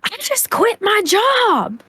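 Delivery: high, slow, hysterical wail; mock wailing imitation of Piper; imitating hysterical Piper. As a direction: mimic her crying it out, stretched and high. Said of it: "finally I hear".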